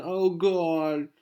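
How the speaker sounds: high-pitched pretend weeping; mock crying. Girl losing it over a breakup, mock crying. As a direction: wail it lightly, as if in tears. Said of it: "She starts crying".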